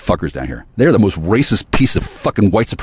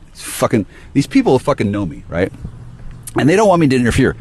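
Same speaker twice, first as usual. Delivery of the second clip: usual speaking voice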